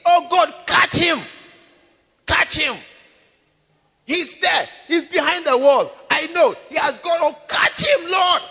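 loud, high accusing shout. Acted. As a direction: yell at God to catch the killer. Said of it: "And he shouted".

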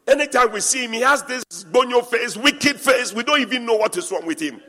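loud, high teasing rant about the angry pastor; animated complaint. Real, not acted. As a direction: raise it up, tease the grump.

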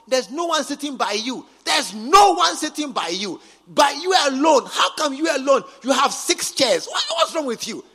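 loud, high preaching rebuke; preacher shouting at the congregation; scolding shout. Real, not acted. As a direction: call them out from the pulpit. Preacher scolds lone seated member.

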